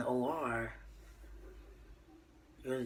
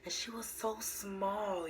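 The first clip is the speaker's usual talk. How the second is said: slow and higher, stretching out "so"; tender awe; 7 semitones higher, slower than usual. Retold or imitated. Mother recalling her newborn daughter, in tender slow awe.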